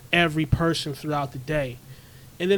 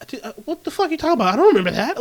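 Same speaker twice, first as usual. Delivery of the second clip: higher, fast, incredulous; baffled retort; 7 semitones higher, 5 dB louder, much faster than usual. Retold or imitated. Stranger baffled by a reminder, fast incredulous retort. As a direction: fire it back, baffled. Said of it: "They're like".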